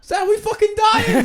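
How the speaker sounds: very high, loud, drawn-out wail; panicking cartoon character. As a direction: wail in mock panic to your pal. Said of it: "what's your character called".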